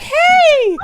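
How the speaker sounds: very high, loud, bright okay; excited Myrtle. Acted. Game master voices Myrtle jumping up eagerly, very high and loud. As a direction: burst out with it. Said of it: "she's just like".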